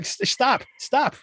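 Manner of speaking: high pleading stop, stop; high mock plea to stop the monologue. Host cutting off the monologue, high mock plea. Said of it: "And I was like".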